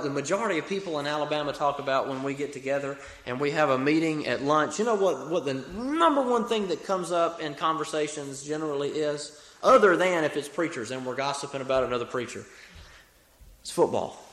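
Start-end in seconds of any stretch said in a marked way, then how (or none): none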